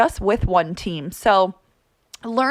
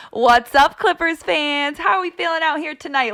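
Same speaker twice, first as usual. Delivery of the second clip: hype announcer voice; 7 semitones higher than usual. Shown as a demonstration. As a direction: fire up the crowd like an arena host. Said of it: "I have to turn it on a little bit at the Clippers games".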